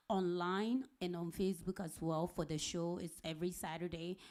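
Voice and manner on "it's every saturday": ordinary delivery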